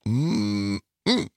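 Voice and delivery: low, pleased mmm of tasting; savoring mm. Real, not acted. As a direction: hum with delight over food. Tasting a cheese curd.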